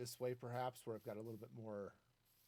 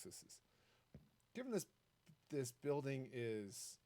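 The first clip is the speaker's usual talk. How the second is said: ordinary talk, nothing marked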